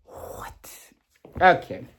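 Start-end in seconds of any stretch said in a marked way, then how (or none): 0.1-0.7 whispered what. Real, not acted. Disbelief at the Gen V plot.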